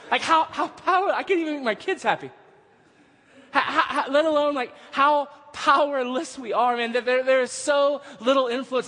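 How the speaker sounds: high-pitched, exasperated preacher in full flow; impassioned preaching. Real, not acted. Preacher to congregation on how powerless we are, impassioned.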